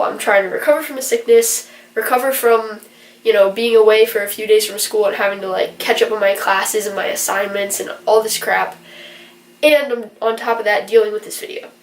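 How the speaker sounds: ordinary talk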